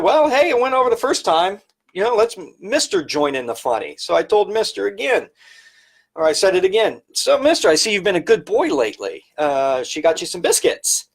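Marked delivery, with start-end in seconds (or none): 0.0-4.0 higher, playful joking tone; retold joking quip. Retelling a joke he tried on his employers, higher and playful. 7.1-11.1 much higher, quick playful teasing; teasing quip. Retold or imitated. Retold teasing of the husband about dog biscuits, high and playful.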